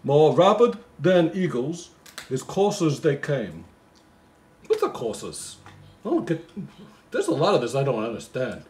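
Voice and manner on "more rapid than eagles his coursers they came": slow, lilting Christmas poem reading; poem recital. Acted. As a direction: read it like a bedtime Christmas poem.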